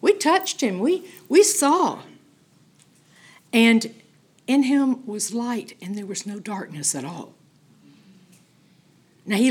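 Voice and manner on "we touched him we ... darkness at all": quoting John in awe; awed retelling. Retold or imitated. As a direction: recall it with hushed wonder rising up. John looks back and says.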